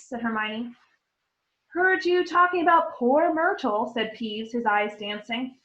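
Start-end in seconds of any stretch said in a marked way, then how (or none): 1.7-3.9 high, gleeful taunt; sly gleeful taunt; teasing. Acted. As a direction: tease them with glee. Peeves teasing.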